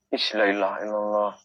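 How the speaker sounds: low, soft dhikr under the breath; murmured prayer. Real, not acted. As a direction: pray quietly to calm yourself.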